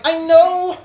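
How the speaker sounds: high, drawn-out protest; exasperated retort. Retold or imitated. Comic answering people who doubt his bit, exasperated retort. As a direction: protest in a high, stretched-out wail. Acting out defensive reply to critics.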